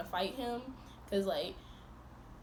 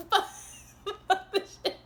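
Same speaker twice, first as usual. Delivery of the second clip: laughing; 12 semitones higher, 7 dB louder than usual. Real, not acted. Laughing outburst.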